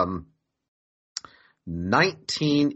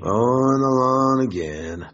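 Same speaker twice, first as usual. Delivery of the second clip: slow, louder drawn-out weary moan; hammed-up bored groan; 3 semitones higher, 5 dB louder, slower than usual. Joking that sex every day is a chore, drawn-out weary moan. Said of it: "No, I'm just kidding".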